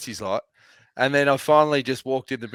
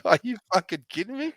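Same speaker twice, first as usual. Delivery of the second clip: disbelief; 8 semitones higher than usual. Retold or imitated. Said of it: "Docs was like".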